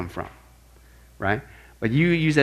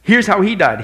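normal talking voice

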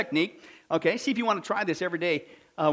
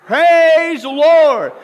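slow, stretched, loud and higher; shout; 5 semitones higher, 12 dB louder, much slower than usual. Retold or imitated. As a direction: cry it out to the street. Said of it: "what she shout".

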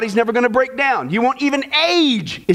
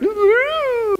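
high, drawn-out "ooh"; imitated fish reaction; 12 semitones higher than usual. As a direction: squeal a long, high mock "ooh". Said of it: "and the fish went".

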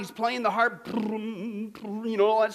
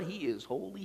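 reverent hush; 5 semitones lower, 13 dB quieter than usual. Acted. Repeated quiet reverent declaration.

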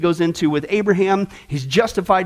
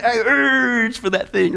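salivating craving; 4 semitones higher, slower than usual. Pretend. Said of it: "a salivating effect".

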